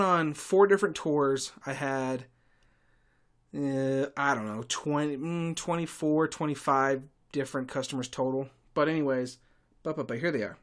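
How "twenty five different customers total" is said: ordinary delivery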